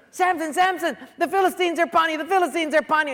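high, loud, rapid warning cry; urgent acted shout, rattled off; mock alarm. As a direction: sound the alarm, hammed up and hurried. Delilah raising the alarm.

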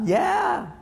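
high-pitched put-on squeal; eager yes. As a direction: agree in a small high voice. Voices the bought listener agreeing.

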